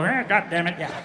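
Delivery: quiet low grumbling; grumpy old man. Retold or imitated.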